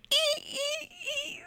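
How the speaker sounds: high shrill screeching ee; Psycho shriek. Retold or imitated. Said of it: "sounded like, uh, Psycho".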